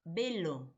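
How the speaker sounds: "velo" said with a B, as "belo"; "velo" sounding like "belo"; V pronounced as B. Shown as a demonstration. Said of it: "Lo pronunciamos con B".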